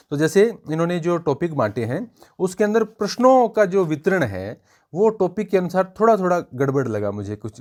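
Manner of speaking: ordinary talk, nothing marked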